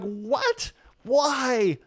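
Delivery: high, incredulous "What? Why?"; hammed-up astonishment; mock shock. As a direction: play up the disbelief, pitched high. Religious group reacts in disbelief.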